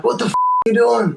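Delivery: high-pitched, incredulous retold outburst; exasperated outburst. Teammate reacting to a botched pass, exasperated. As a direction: act out a baffled teammate's high-pitched protest.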